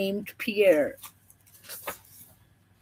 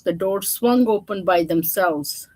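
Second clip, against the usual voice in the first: ordinary delivery, nothing marked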